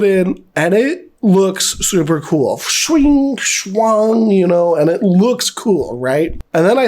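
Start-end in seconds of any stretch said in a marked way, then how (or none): 2.6-4.3 high, ringing swoosh sliding down; imitated blade swish. Showing blades going into the slide, imitated swish. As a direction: make the metallic sound effect.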